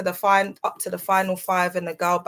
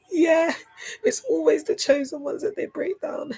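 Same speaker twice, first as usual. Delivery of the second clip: much higher, strained voice on the verge of crying; tearful; 10 semitones higher, 4 dB louder than usual. Real, not acted. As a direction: let the tears rise into the words. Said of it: "I'm so overwhelmed".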